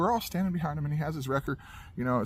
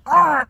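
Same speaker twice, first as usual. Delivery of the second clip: loud, high burst of crunching noise; explosive mouth sound effect; imitated wrenching noise; 12 semitones higher, 11 dB louder than usual. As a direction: mimic metal jerking to a stop. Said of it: "it goes".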